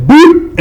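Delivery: high, loud punch "boop"; short high-pitched mock impact noise; imitated punch sound. Retelling a hit to the lip, high loud boop.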